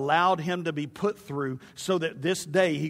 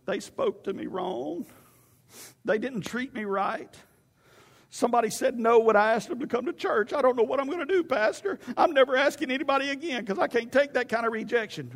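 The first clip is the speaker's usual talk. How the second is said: wounded complaint climbing higher; mock whining; 4 semitones higher than usual. Churchgoer complaining to the pastor about rejection, mock whining. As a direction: imitate a sulky, hurt churchgoer. Mimics Christians licking their wounds.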